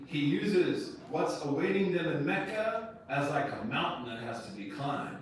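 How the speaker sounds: normal talking voice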